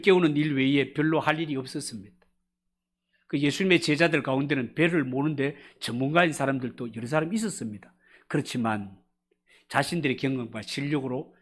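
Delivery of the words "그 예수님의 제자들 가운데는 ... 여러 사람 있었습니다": usual speaking voice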